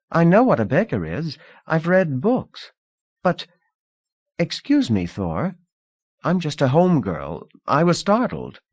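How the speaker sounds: put-on breezy rich girl offering comfort; Lida, young hostess.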